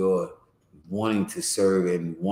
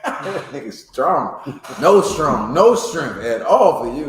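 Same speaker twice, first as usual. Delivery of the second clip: higher, louder, words stretched through laughter; laughing tease; 5 semitones higher, 6 dB louder, much slower than usual. Real, not acted. Teacher teases the reader over the slip, laughing. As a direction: crack up while ribbing a friend.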